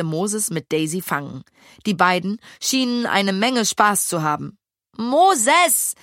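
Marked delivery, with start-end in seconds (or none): 5.0-5.9 high, loud bellowed name; calling the pig, bellowed. A narrator's reading. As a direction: roar his name across the garden. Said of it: "brüllte Lille".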